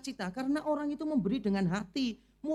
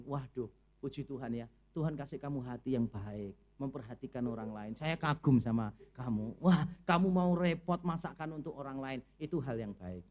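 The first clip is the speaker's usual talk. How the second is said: much softer and lower, sweetly praising; gentle praise; 9 semitones lower, 5 dB quieter than usual. Shown as a demonstration. Preacher showing the congregation how to praise a cook, softly. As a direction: soothe the cook with kind words. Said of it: "saudara puji gini".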